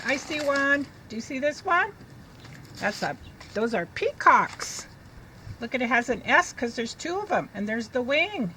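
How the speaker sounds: ordinary talk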